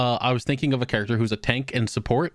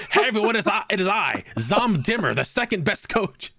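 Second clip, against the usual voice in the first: grand, higher announcing voice, slowing at the end; evil spirit coach; 8 semitones higher than usual. Acted. Player voicing the possessing evil spirit to the party, hammed-up grand announcement. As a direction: proclaim yourself like a hammy demon.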